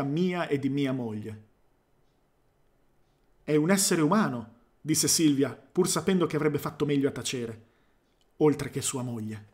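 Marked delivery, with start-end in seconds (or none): none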